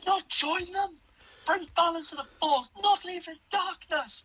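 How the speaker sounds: high, strained anguished cry; anguished Obi-Wan. Retold or imitated. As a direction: wail it out in heartbreak. Continuing Obi-Wan's scream.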